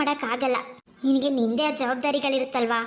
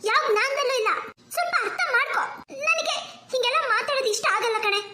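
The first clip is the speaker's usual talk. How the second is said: higher, with ಯಾವ pushed hard; upset insistence; 10 semitones higher than usual. Acted. Bride insisting to her friend she isn't dreaming, upset. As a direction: repeat it, annoyed, leaning on ಯಾವ.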